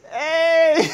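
high squealing laughter; laughing fit. Real, not acted. Laughing hard at his own rant, squealing laughter. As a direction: crack up.

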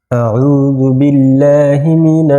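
slow, drawn-out melodic Arabic recitation; Quran recitation. Acted. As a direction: intone each word long and reverently. Ta'awwudh and basmala recited over music.

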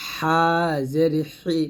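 "হা জের হি" with a pressed mid-throat "হা". Shown as a demonstration. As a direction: squeeze "হা" from the middle of the throat. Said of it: "হা চাপ দিয়ে".

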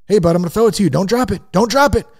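father nagging his son at catch with mounting warnings. Retold or imitated. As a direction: press the warning harder each time. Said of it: "planted the seed of doubt".

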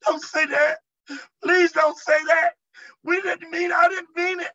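high, pleading whimper in imitation; mock-crying plea, high and loud. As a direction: whimper and beg like a frightened demon. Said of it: "began to just whimper".

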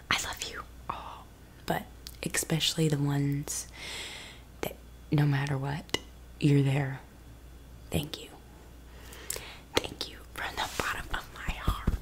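tender hushed whisper; soft ASMR whisper. Acted. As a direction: whisper thanks close and quiet.

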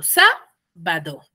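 "sábado" with a lifted SA and a low, continuous "bado"; stress on SA. Shown as a demonstration. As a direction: punch the SA of "sábado", let "bado" run on low. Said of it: "es continuo en la ba y en la do". (acento en la SA de «sábado», «bado» seguido)

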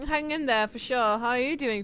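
usual speaking voice

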